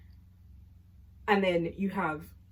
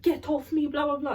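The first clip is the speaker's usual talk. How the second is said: higher-pitched, mock-angry snap; angry retort; 8 semitones higher, 4 dB louder than usual. Chloe snapping at Millie mid-argument, imitated and higher. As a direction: throw back an irritated get-off-me. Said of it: "getting so mad".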